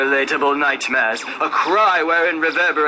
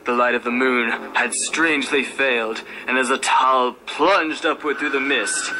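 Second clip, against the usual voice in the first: usual speaking voice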